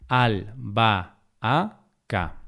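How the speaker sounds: albahaca broken slowly into al, ba, a, ca; syllable by syllable. Shown as a demonstration. Teacher dividing albahaca to show the hiato, syllable by syllable. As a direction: say "albahaca" as four separate syllables.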